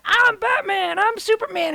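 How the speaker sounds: high, loud imitation of children; kids playing superheroes. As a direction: call it out like excited kid heroes. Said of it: "hear my kids going back and forth".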